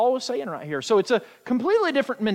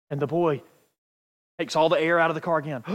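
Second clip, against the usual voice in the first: usual speaking voice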